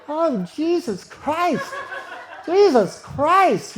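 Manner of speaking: loud, high, slow drawn-out groan of disbelief; overwhelmed cry. Retold or imitated. Man pinned under her crying out, slow and loud. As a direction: let it out like you can't believe what's happening. Overwhelmed exclamation during sex.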